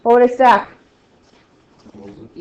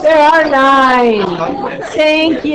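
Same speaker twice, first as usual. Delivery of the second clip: relieved thanks; 5 semitones higher, 8 dB louder, much slower than usual. Real, not acted. Relief at the right answer.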